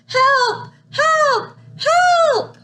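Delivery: climbing cry for help. Acted. Baby sister shouting for rescue from the dragon's cave, building cries. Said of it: "the seventh sister cries even louder".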